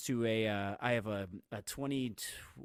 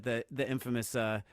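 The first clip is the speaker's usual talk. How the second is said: ordinary delivery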